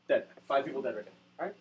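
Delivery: ordinary delivery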